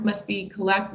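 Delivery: ordinary delivery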